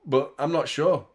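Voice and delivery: ordinary talk, nothing marked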